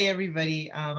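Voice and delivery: usual speaking voice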